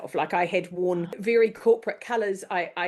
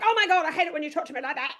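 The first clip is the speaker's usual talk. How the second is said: much higher and fast, mock hysterical whine; hammed-up outburst; 9 semitones higher, much faster than usual. Mocking a colleague who storms out of a meeting, hysterical whine. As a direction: overplay a melodramatic tantrum. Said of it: "without being like".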